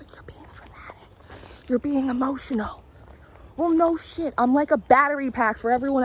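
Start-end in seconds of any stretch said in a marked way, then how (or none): 0.0-0.1 whisper. Retold or imitated. Whispered mockery of others. 0.1-2.8 hushed, mocking whisper. Everyone dismissing her, a hushed mocking whisper.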